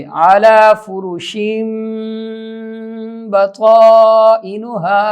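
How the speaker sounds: slow tajweed with long hummed m; Quran recitation. Shown as a demonstration. Teacher demonstrating the corrected phrase, slow recitation. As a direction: hold the nasal and long vowels.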